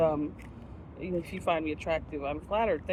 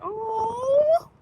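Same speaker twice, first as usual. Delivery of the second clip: high, loud and sudden, caught off guard; sudden startled cry; 5 dB louder than usual. Real, not acted. Eating on camera, suddenly startled by something behind. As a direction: yelp as something catches you off guard.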